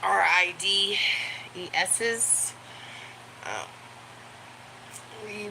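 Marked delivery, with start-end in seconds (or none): 0.0-2.5 each letter of "R I D E S's" said separately; spelled out. Shown as a demonstration. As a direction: spell "R I D E S's" out letter by letter so the dog won't catch it. Spelling word so dog won't hear.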